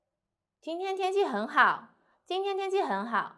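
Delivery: high start, 很好 dropping at the end; falling statement intonation. Shown as a demonstration. Teacher showing a statement sentence, 今天天气很好 going down. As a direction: let 今天天气很好 fall on the last word. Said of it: "go down is a statement sentence".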